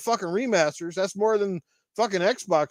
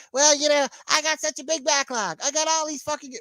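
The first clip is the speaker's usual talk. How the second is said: higher, hammed-up excuse-maker voice; mock whiny gamer; 6 semitones higher than usual. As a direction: mimic a lazy gamer whining about his backlog. Mocking gamers' backlog excuse.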